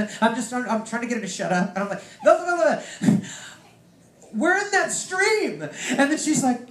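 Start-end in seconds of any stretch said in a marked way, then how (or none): 2.2-5.6 high, loud and drawn-out calling her name; slow, soaring cry of recognition; excited outburst. Retold or imitated. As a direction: cut her off, bursting with the news. Speaker cutting off aunt excitedly.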